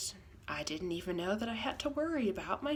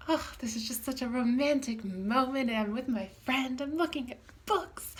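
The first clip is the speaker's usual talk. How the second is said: mock-dreamy, bright and higher, lost in the moment; dreamy delight; 5 semitones higher, 5 dB louder than usual. Oblivious friend browsing books, daydreaming out loud in dreamy delight. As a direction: gush over the books like a daydreamer. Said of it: "I am so lost in myself".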